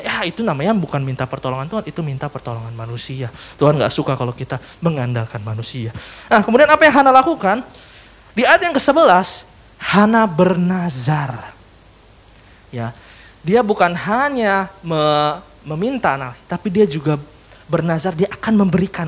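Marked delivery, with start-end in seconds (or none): none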